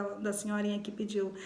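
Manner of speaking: ordinary talk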